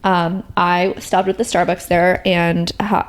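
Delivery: ordinary talk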